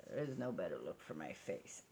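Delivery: dropped low and soft, dry; deadpan self-mocking aside. Real, not acted. Vlogger mocks her own face, low dry aside. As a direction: mutter the joke at yourself.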